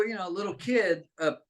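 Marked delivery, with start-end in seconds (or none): none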